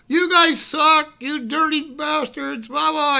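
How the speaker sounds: high, slow, drawn-out heckling holler; yelled insults. Retold or imitated. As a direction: call abuse from far away, stretching the words. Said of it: "Dave yells at the top of his lungs".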